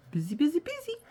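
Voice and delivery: rising busy busy busy; hurried little rabbit. Acted. Responsible rabbit bustles.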